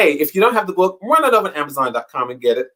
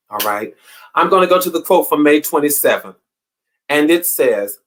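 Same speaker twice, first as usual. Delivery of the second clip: usual speaking voice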